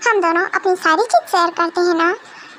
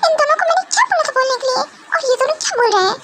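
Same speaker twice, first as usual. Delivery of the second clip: flustered rush; 5 semitones higher, faster than usual. Acted. Girl flustered aside.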